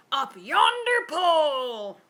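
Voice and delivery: loud, slow group shout; rowdy pirate echo; crew shout. A narrator's reading. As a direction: shout it back together. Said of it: "the others shouted".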